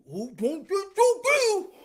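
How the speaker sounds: high, slow hooting speech; imitated deaf man. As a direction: mimic deaf speech with rounded, blurred words.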